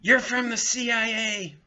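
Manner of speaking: high, loud panicked woman accusing them; shrieking accusing lady. Retold or imitated. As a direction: shriek it like a frightened neighbour on her balcony. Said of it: "and she goes".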